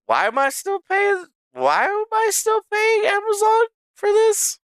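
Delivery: way up high, whiny incredulous question; exasperated disbelief. Real, not acted. Streamer baffled he still pays Amazon, high exasperated whine. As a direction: squeal the complaint in disbelief. Complaining about Amazon prices.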